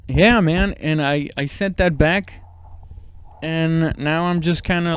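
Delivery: usual speaking voice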